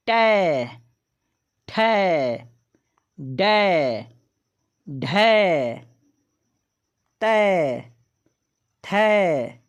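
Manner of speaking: teacher reciting गै, घै, चै in a slow drill; syllable drill. Shown as a demonstration. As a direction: chant the ai-matra syllables one by one for the class. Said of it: "तो इसका उच्चारण होगा खै".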